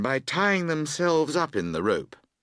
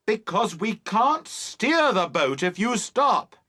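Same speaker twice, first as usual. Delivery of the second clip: slow, high emphatic shout; 9 semitones higher than usual. A narrator's reading. Boatman explaining steering to Emily, shouting. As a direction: shout it out word by word.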